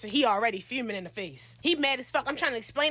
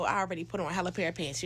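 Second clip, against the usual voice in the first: usual speaking voice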